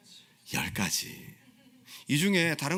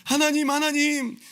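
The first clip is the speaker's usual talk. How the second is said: high, slow cry of God's name; calling on God; 11 semitones higher, 5 dB louder, slower than usual. Retold or imitated. Preacher modelling a believer crying to God, high and drawn out. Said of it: "그런 아이덴티티를 가지고 기도하시라는".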